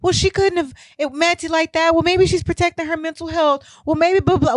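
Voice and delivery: high, sing-song mock of a naive defender; mocking the commenters. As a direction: ham up the excuse-makers' whiny voice. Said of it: "you're like".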